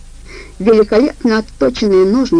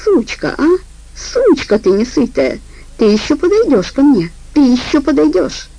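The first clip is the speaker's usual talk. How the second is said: wheezing hiss; 6 semitones higher than usual. Acted. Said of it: "свистел заложенным носом и хрипел".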